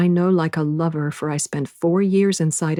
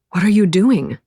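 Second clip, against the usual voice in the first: louder question, out of breath; winded, raised voice; panting; 3 semitones higher, 6 dB louder than usual. A narrator's reading. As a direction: pant out a startled question. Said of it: "he pants".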